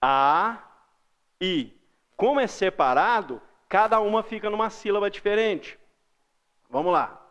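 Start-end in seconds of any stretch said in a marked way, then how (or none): 0.0-1.7 "A", pause, then "I"; separated hiatus "A, I"; one vowel at a time. Shown as a demonstration. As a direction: hold the A, stop, then a separate I. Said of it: "a gente fala uma, lembra".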